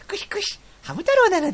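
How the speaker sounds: high squeaky hamster voice with whispery squeaks; tiny, high-pitched mascot impression; little hamster character. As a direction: imitate a cute cartoon hamster. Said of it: "なんかハムスターみたいなやつさ".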